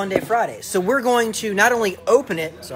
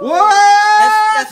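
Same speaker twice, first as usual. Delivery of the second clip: high, loud stunned disbelief; shocked shout; 14 semitones higher, 14 dB louder than usual. Real, not acted. Shocked at huge giveaway.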